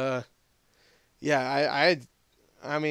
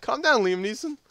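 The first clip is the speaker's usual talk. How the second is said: raised pitch, loud start easing off; hammed-up protest at a rampage; mock-alarmed reaction; 8 semitones higher, 5 dB louder than usual. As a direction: exclaim as if taken aback, playing it for laughs. Retells own reaction to smashing monster.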